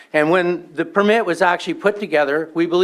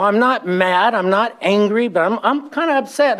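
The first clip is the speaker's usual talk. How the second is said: normal talking voice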